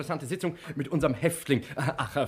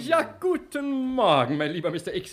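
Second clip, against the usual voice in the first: effusive welcome; 8 semitones higher, 4 dB louder than usual. Acted. Director greets prisoner effusively.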